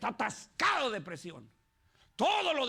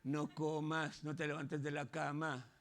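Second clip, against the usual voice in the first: much lower, softer, slow insinuating voice; whispering tempter voice; 7 semitones lower, 5 dB quieter, slower than usual. Acted. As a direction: lure them lazily like a tempting inner voice. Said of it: "viene la voz y le dice". (voz interior tentadora, baja y lenta)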